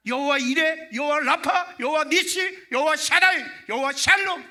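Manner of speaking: preacher thundering the divine names to the congregation; full-force preaching. Acted. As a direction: cry out every name like a herald. Preacher proclaims God's names.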